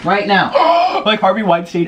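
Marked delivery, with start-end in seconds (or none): 0.5-1.0 sharp startled gasp; shocked gasp. Real, not acted. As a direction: gasp at your own words. Shocked at her own joke.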